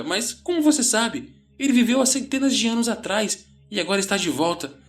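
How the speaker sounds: high, agitated panicked rant; frenzied. A narrator's reading. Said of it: "Ele estava entrando em frenesi".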